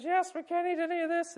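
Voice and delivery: high mock woman's voice; Eve's voice. Pastor voicing Eve answering the serpent, high-pitched. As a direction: pitch up for Eve, light and naive.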